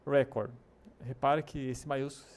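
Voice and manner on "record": "Record" as REC-ord, first syllable louder; first-syllable stress. Shown as a demonstration. Teacher models the noun for a disc, "Record" stressed at the start. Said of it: "maiúsculo significa ênfase".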